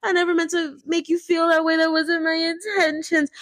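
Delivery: high, tearful mock apology; mock crying apology. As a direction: imitate someone crying an exaggerated sorry. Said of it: "start crying and is like".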